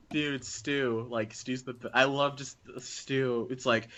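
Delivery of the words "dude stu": ordinary talk, nothing marked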